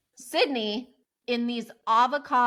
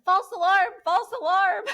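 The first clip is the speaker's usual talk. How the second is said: laughing; 9 semitones higher than usual. Retold or imitated. Telling her neighbor it's a false alarm, laughing. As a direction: call it off while cracking up.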